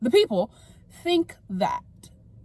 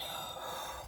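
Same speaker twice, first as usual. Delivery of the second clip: calming sigh; 16 dB quieter than usual. Real, not acted. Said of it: "Let me calm down".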